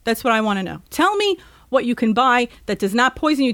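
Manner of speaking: ordinary delivery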